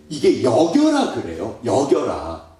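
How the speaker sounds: normal talking voice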